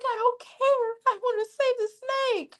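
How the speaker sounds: high-pitched ditzy lady in a joke; shrill lady. Acted. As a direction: play the panicked lady. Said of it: "She's like".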